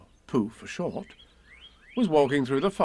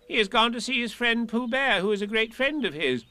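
much higher disguised Rabbit voice; 11 semitones higher than usual. Acted. Rabbit tells Pooh he's away, disguised voice. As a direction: fib in a put-on voice.

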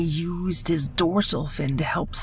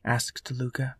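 plain reading voice

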